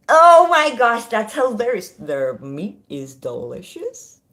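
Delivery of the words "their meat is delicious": slow deadpan quote. Retold or imitated. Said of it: "I'm gonna have to say this".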